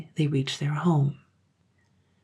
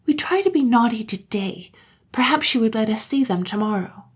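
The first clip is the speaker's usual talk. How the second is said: put-on high, raised child voice; childlike, higher-pitched proposal; child plotting; 6 semitones higher, 4 dB louder than usual. A narrator's reading. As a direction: suggest it like a scheming little girl. Turkey, a child, suggests a plan.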